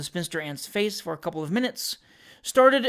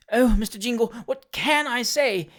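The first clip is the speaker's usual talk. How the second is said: flood of despair; 6 semitones higher, 3 dB louder than usual. A narrator's reading. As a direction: lament theatrically as a distressed spinster. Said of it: "in another flood of despair".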